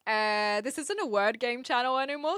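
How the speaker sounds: ordinary talk, nothing marked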